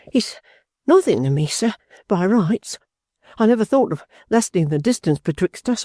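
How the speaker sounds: with emotion. A narrator's reading. Young John pleading with Mister Dorrit, emotional. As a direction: plead your honesty with feeling. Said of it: "said young John with emotion".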